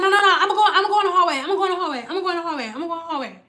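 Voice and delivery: high, rapid repetition that fades away; agitated insistence. Retold or imitated. Retelling an agitated woman insisting.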